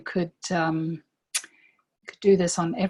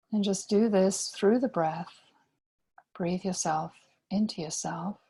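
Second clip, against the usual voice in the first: guided meditation. Real, not acted. Guide inviting listeners to breathe into themselves, calm meditation voice. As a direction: lead them gently inward, leaving space between phrases.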